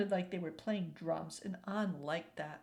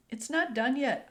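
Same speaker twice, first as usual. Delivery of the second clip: put-on louder, higher child protest; mild protest; 4 semitones higher, 6 dB louder than usual. A narrator's reading. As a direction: push back like a child.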